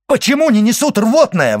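high, loud impatient demand; exclaiming. A narrator's reading. Doctor demands the emetic be brought, exclaiming loudly. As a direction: cry out urgently for help to come. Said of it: "воскликнул доктор".

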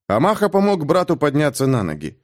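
ordinary delivery, nothing marked

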